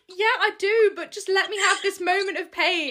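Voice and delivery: milk the pain for laughs; playful whine to the boyfriend; mock self-pity.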